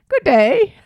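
loud, high hammed-up British accent. Imitating a childhood act for the camera, British accent. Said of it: "He was talking a British accent".

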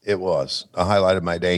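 ordinary talk

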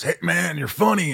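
high, friendly wrestler voice; imitating a friendly wrestler; imitating Rowdy Roddy Piper. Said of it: "he goes".

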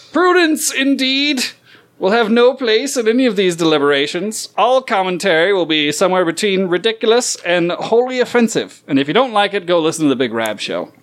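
high, lofty declaiming; pompous orator's flourish; grand proclamation. Acted. Declaration closing, raised pitch.

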